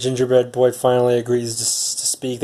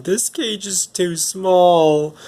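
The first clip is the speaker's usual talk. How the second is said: put-on drawn-out whiny baby bear, higher; mock-cry it slowly in a higher voice; 6 semitones higher, much slower than usual. Caged baby bear complains about his tiny cage, mock crying. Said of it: "a baby bear crying in his cage".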